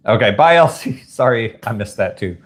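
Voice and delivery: usual speaking voice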